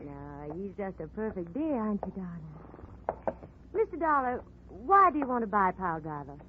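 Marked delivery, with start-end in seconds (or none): 0.0-3.4 cooing to horse. Acted. Lila cooing to her horse.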